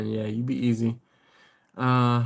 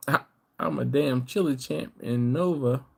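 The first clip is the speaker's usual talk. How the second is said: usual speaking voice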